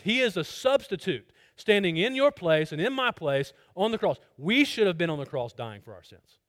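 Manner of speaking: loud, driving sermon delivery; preaching. Acted. Preacher urging listeners with forceful loud preaching.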